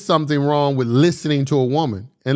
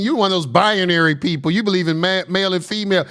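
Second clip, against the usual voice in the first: usual speaking voice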